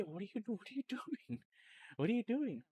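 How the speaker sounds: high, stunned what are you doing; mock-incredulous, laughing at the donation; high-pitched disbelief, half laughing; shocked disbelief. Retelling shock at big donation.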